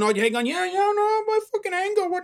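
high, scoffing mock voice of a defensive listener. Mimicking a listener defending their anger, high and scoffing. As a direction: squeak it out like someone brushing you off. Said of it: "you might be one of them right now going".

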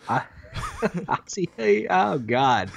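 laughing through the name. Real, not acted. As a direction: break into laughter mid-name. Stumbling over Japanese name.

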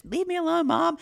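put-on high whiny teen voice. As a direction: pitch way up, whining at mom. Teen snapping back at mom.

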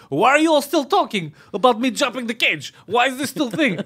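high-pitched exasperated fighter impression; Khabib. Fighter at press conference about the cage jump, mock exasperated. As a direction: complain in mock disbelief. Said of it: "He's like".

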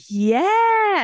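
high, loud, drawn-out reply; retold emphatic confirmation. Strangers confirming her guess to her, retold emphatically. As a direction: imitate an emphatic chorus of agreement.

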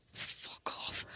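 whispered swear, barely voiced; whispered retort. Real, not acted. Cohost swearing at the other in jest, whispered. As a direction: hiss it under the breath in a whisper.

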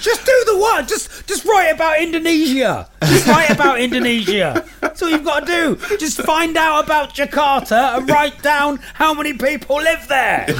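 a squeaky, laughing caricature of a parent barking out a homework task; mock lazy teacher. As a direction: send up your own lazy teaching in a squeaky voice. Mocking his own lazy teaching.

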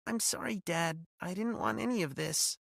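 put-on small, sorry young voice; apologetic daughter. A narrator's reading.

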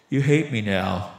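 low, slow, glum voice; dejected friend. Retold or imitated. Friend after choking his buddy, low and dejected. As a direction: drag it out low and sorry for yourself. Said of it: "buries his head in his hands".

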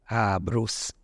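a hint of the Russian boss savouring the name; Russian accent. Rich boss picking the ugly dog, mild Russian accent. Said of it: "had a strong Russian accent".

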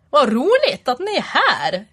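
high, singsong attempt at Dalarna dialect; badly faked Dalarna lilt; Dalarna accent. Shown as a demonstration. Imitating dialects on request, shaky-aimed Dalarna accent. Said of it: "Eh, dalmål".

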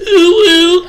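high breathy cutesy coo; gamer girl ooh woo. Shown as a demonstration. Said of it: "I just need you to say".

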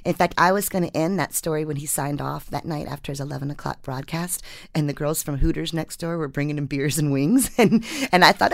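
normal talking voice

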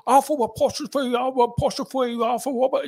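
spelling it out, R's as W's; Bobby spelling his name down the phone; can't say his R's. Retold or imitated. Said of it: "he couldn't pronounce his R's".

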